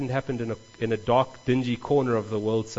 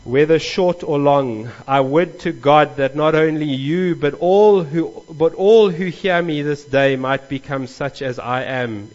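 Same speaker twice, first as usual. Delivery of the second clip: preaching; 3 semitones higher, 7 dB louder than usual. Acted. Paul's appeal read aloud.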